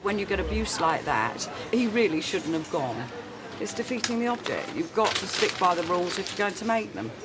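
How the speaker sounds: usual speaking voice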